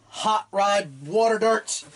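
ordinary talk

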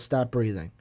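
low, quiet order; cold villain command. Retold or imitated. Retelling the villain silencing the stepdad, low and quiet. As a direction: murmur the order, icy.

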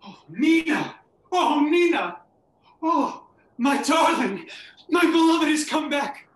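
loud, high, ecstatic calling out; breathless delighted exclamation; overjoyed cry. Acted. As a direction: burst out with joy at her return. Joyful reunion with Nina.

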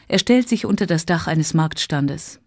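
plain reading voice

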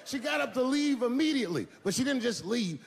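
ordinary talk, nothing marked

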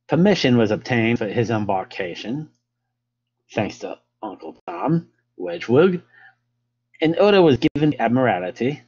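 hammed-up amateur English accent, lilting. Lecturer reading Fitzroy's account to listeners, in an English accent. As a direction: read it out in your best English accent. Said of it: "best English accent".

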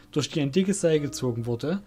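usual speaking voice

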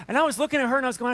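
ordinary talk, nothing marked

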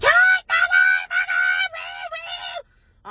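squealed high and loud, hammed up; mock jubilant shout. Wife mock-cheering at finding old nude pictures, high and shrill. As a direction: crow with sarcastic glee. Mock celebrating the found photos.

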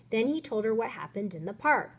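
usual speaking voice

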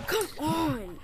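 loud, high exasperated come on; frustrated yell. Real, not acted. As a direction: yell it at the screen. Frustrated at the game.

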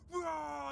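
drawn-out "rah" of a racing car; excited mock engine roar; imitated car roar. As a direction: roar it like the car going past. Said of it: "It was all like".

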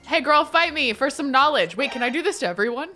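ordinary talk, nothing marked

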